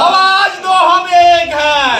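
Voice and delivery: slow shouted rally call; protest slogan call. Real, not acted. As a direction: stretch the call to the crowd. Leader calls out the slogan.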